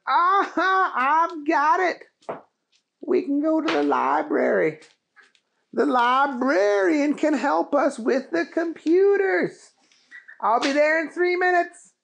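high, loud eureka shout; excited Keith. Acted. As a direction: burst out with the idea.

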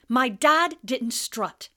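put-on loud, slow, indignant boy; furious schoolboy; angry Harry.

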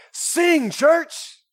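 high, loud rallying shout; worship leader's call. Retold or imitated. Worship leader cueing the congregation on the last chorus, shouted. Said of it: "he'd always go".

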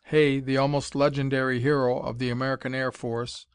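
ordinary delivery, nothing marked

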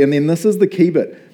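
ordinary delivery

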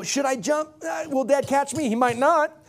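put-on doubting little girl. Dad voicing his daughter unsure about jumping, hesitant. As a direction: voice a scared small girl on the diving board, lightly.

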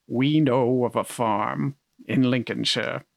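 slow, stately mock commercial read; Orson Welles. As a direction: imitate a grand narrator, stretching the words. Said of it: "he would just be like".

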